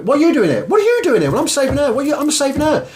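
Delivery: high, rising mock-indignant back-and-forth; lightly acted bickering; mock lifeguard squabble. Retelling two lifeguards clashing over the rescue, as a mock squabble.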